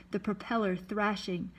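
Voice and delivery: ordinary delivery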